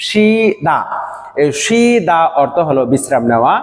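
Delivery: ordinary talk, nothing marked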